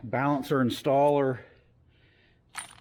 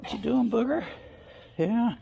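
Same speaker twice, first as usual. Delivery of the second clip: much higher pet-talk voice; 11 semitones higher than usual. Real, not acted. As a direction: squeal it to the dog. Talking to the dog.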